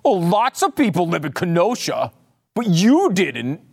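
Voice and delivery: mocking imitation of a prosecutor's gotcha question. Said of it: "your dad lived in, my dad lived in Kenosha".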